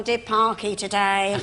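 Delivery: slow, drawn-out camp voice; slow camp drawl. Retold or imitated. Husband imitating Kenneth Williams asking about the show. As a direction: stretch it out, camp and languid. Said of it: "He'd come home and said".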